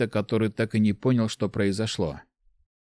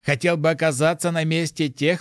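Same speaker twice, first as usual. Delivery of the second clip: higher, challenging mage; 6 semitones higher than usual. A narrator's reading. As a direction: press the boy hard.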